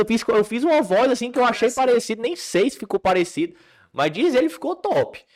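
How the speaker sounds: high, loud rush of laughing denial; excited confession. Real, not acted. Comedian admits he faked the impression, high and giddy.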